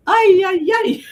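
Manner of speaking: high, loud ay ay ay through laughter; laughing exclamation. Real, not acted. Laughing at her airport mask story, high and loud. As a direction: cry out while cracking up.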